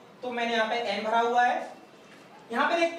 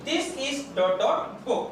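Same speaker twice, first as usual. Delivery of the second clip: this is dot dot book, spaced word by word for copying; a teacher dictating each word slowly; very slow dictation; much slower than usual. Shown as a demonstration. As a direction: read it slowly so students can write. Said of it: "reads it again very slowly".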